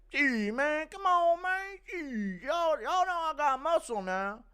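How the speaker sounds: high, loud cartoonish muscleman voice; Terry Crews. Streamer impersonating an actor for a viewer, high and loud. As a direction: push it high and drawn out. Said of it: "impression of Terry Crews".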